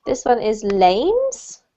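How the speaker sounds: slow "lanes" with a clear final S; slow model word. Shown as a demonstration. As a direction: model "lanes" slowly, landing the -nes ending. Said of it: "Would you like to repeat it?".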